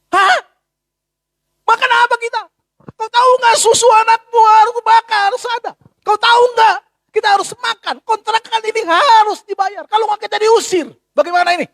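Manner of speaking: high, loud furious scolding; angry wife. Acted. Wife shouting at her newly fired husband, high and loud. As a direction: shout it at him, panicked and furious. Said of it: "dia mau istri marah-marah, marah sekali".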